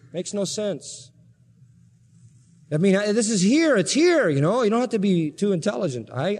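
normal talking voice

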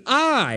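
"I" said high and stressed, held alone; emphatic I. Shown as a demonstration. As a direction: lean hard on "I" before pausing. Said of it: "and it's emphatic".

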